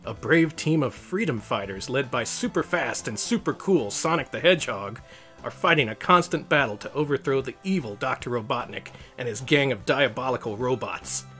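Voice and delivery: ordinary delivery, nothing marked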